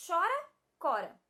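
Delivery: "chora" with a sh sound, then "cora" with a hard k; contrasted pronunciations. Shown as a demonstration. Teacher compares words for pupils, clearly contrasted "chora", "cora". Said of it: "Que mudança a letra H causou na pronúncia".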